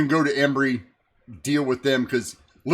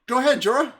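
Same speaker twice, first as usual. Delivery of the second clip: high, barked go-ahead; npc commander voice; 11 semitones higher, faster than usual. Acted. Commander telling Jorah to report. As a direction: snap the order high and sharp. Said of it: "he goes".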